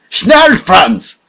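loud, high German shout; urgent cry. A narrator's reading. Conspirator crying out to Franz in the dark, loud. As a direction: yell the warning across the dark room. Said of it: "cried a voice".

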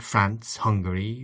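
ordinary delivery, nothing marked

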